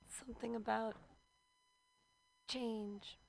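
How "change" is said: ordinary talk, nothing marked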